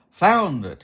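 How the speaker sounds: high and loud with delight; beaming. Acted. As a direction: beam it out triumphantly. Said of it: "he beamed".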